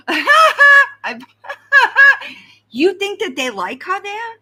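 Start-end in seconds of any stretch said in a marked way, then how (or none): 0.1-2.3 big, high, loud laugh that breaks the sentence; loud burst of laughter. Real, not acted. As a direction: crack up laughing in the middle of a thought.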